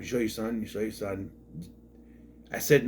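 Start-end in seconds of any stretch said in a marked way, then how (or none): 0.0-1.3 lightly mocking, softer nagging echo; imitating nagging friend. Mimicking Big L T nagging him about his son, soft mock nag. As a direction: echo the pestering question, a bit quieter. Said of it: "ask me a million times".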